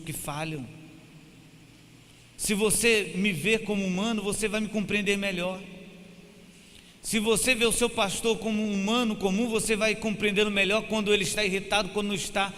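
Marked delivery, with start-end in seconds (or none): 2.4-12.5 higher and louder, pleading with the church; impassioned preaching. Acted. Pastor begging church to see him as human, impassioned preaching. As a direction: preach it with fire, urging understanding.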